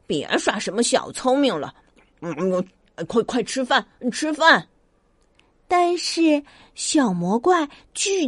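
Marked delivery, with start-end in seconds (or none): 0.1-4.7 mumbling mother with food in mouth. Acted. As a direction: mutter it through a mouthful. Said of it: "妈妈也嘟嘟囔囔地说".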